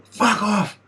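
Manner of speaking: shouting at dogs nipping his heels; yelling at dogs. Retold or imitated. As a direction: yell it at chasing dogs. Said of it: "turning around yelling".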